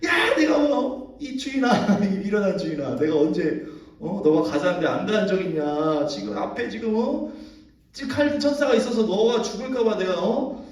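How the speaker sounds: high, laughing protest as the donkey; indignant talking donkey. Retold or imitated. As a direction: complain like a wronged donkey, half laughing. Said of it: "입이 터져가지고 말을 하는 거예요".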